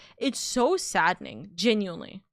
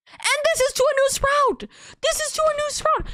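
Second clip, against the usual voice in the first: much higher, incredulous outburst at the unfairness; outraged disbelief. Real, not acted. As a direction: pitch it up in shocked indignation. Ranting streamer, outraged disbelief.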